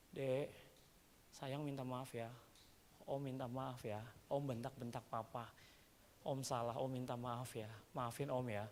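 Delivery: soft, quiet, gentle apology; tender apology. Retold or imitated. Pastor gently apologising to the scared child in the car. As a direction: soothe a frightened child softly.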